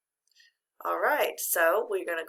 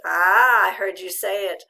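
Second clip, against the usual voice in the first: delighted exclaim; 9 dB louder than usual. Real, not acted. Teacher thrilled by the answer.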